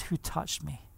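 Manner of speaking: very soft and low, almost under the breath; hushed question. Retold or imitated. Yeshua asking the crowd who touched him, hushed. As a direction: drop right down to a quiet, low question.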